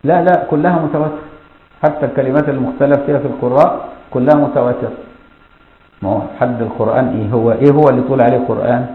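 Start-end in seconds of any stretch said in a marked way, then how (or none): none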